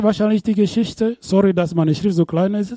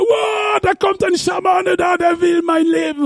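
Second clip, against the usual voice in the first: high panicked yell about a shaman; panicked shout; 12 semitones higher than usual. Retold or imitated. Preacher acting his own fright at a ghost, panicked shout. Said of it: "Ich habe gesagt".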